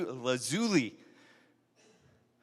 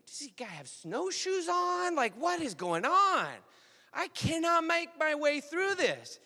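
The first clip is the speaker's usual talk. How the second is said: put-on young boy voice; 12 semitones higher than usual. Boy Jason calling out to grandpa, high and frantic. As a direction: play the boy lost in the drifts, pitched up high.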